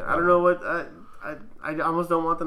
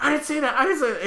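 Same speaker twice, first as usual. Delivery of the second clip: high-pitched, loud mock protest; frantic denial; 12 semitones higher, 6 dB louder than usual. Driver overcompensating before the manager, mock frantic. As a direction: imitate desperate, repeated backpedalling.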